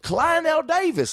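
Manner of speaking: hammed-up Southern accent like a pompous house-tour guide; thick Southern accent. Goofing on a YouTube tour bit, thick Southern accent. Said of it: "with a thick Southern accent".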